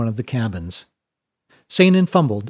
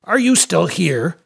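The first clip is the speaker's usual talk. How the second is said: raised pitch, slowing into a pointed question; stern dismissal; 8 semitones higher, 3 dB louder than usual. Acted. Captain ordering the gambler away, stern and high-pitched. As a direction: dress down an intruder with some heat.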